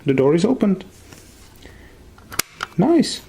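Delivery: high pleased exclamation; delighted. Real, not acted. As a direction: celebrate the fix. Door finally opens.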